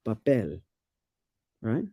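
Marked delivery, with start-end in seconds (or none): 0.1-0.6 papel stressed on PEL, pitched lower; last-syllable stress. Shown as a demonstration. Giving partner the example, papel stressed on PEL. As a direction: lean on the end of papel, pa-PEL. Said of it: "stress usually falls on the last syllable".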